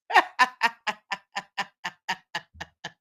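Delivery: real burst of laughter; cracking up at a chat joke. Real, not acted. Host laughing at a viewer's comment about bald people crying.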